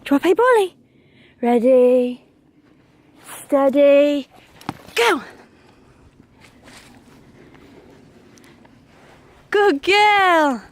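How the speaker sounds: excited doggy play voice. Real, not acted. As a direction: hype her up for the ball. Excited fetch game with dog.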